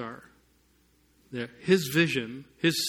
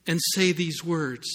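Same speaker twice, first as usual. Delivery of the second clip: ordinary delivery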